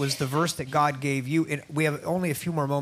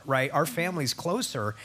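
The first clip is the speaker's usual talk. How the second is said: ordinary talk, nothing marked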